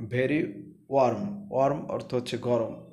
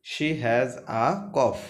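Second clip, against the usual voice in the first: each word drawn out slowly; slow model reading of She has a cough; much slower than usual. Shown as a demonstration. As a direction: read it very slowly for the class. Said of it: "teacher reads sentence slowly".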